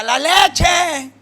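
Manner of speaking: loud hawker's cry; vendor's shout. Retold or imitated. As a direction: call out the milk down the street. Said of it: "Y luego grita".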